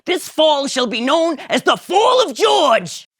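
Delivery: high, loud slow shout; grand proclamation. Acted. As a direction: declare your triumph. George proclaims.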